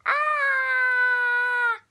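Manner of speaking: high, loud scream; startled scream. Acted. Chicken leg kid hurled down the lane, screaming. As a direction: scream as you get thrown. Crash in bowling alley.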